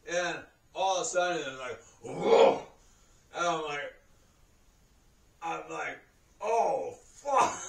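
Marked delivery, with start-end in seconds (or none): 2.0-2.6 loud, breath-heavy "whoa" outburst; sudden loud whoa. Retold or imitated. Telling what hit all of a sudden, loud whoa. 6.4-7.6 high, loud "oh" of dismay; loud dismayed curse. Retold or imitated. Man reliving his accident, loud dismayed curse.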